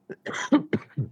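rough hacking cough; smoker's cough. Real, not acted. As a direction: hack it out. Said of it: "Smoker's cough".

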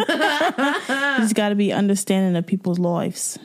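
hammed-up British accent; jokey mock-British accent; put-on British accent. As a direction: play it as a Brit for laughs. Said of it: "I'll talk in an accent".

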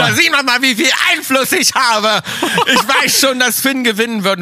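gleeful laughing gloat; laughing boast. Real, not acted. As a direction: cackle while bragging about the inside scoop. Boasting about insider knowledge.

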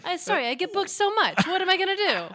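high, smug humblebrag; mock bragging. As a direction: brag in a mock diva voice. Mock bragging about bookings.

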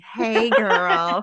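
put-on bubbly drawn-out Valley Girl greeting. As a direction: stretch the hey, Valley Girl style. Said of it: "I sounded really Valley Girl there".

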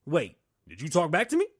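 loud, accusing cop voice; mock cop. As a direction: bark it like a cop hunting for trouble. Acted cop during traffic stop.

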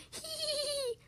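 high, thin hee-hee laugh; wheezing giggle. Acted. Tickled dragon running out of breath, high wheezing laugh. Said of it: "wheezed the dragon".

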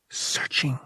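soft breathy echo of the word; a hushed second searching; whispered echo. A narrator's reading. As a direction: let the word fade to a hush. Narration echo, whispered repeat.

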